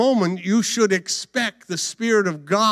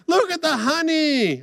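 hammed-up high wonder; gleeful discovery; 12 semitones higher, 4 dB louder than usual. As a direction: gush over the find. Acting Samson seeing the honey.

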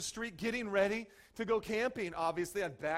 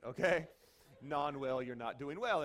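usual speaking voice

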